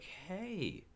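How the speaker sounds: mock excited exclaim; mock delighted hey. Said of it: "I was like".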